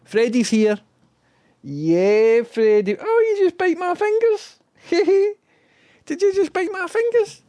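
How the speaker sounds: high, loud squeaky pet voice. Real, not acted. Owner fusses over dog Freddy in a high squeaky voice.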